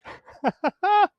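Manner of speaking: sudden high loud laugh bursting out; burst of laughter. Real, not acted. Streamer cracks up at joke.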